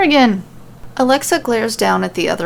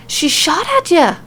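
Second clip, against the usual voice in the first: higher, snarled angry outburst; angry growl; 8 semitones higher than usual. A narrator's reading. Nikki snapping at Alexa about the shooter, angry growl. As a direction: spit it out in anger. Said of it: "Nikki growls angrily".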